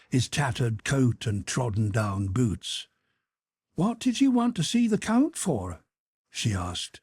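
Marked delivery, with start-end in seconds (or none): 3.7-5.8 put-on high, kindly questioning old woman; curious old housekeeper. A narrator's reading. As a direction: ask the young man gently. Housekeeper asks the officer.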